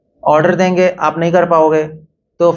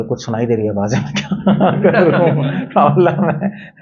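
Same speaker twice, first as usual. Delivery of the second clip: laughing worried patient. Retold or imitated. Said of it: "आप doctor के पास चले जाओगे".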